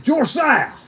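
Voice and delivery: excited. Retold or imitated. Fan excitedly greets him.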